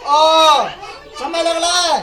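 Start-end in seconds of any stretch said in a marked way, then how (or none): 0.1-0.7 loud drawn-out ओ held for the pluta vowel; a teacher's extended ओ sound; long vowel demo. Shown as a demonstration. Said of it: "जैसे हम देखिए".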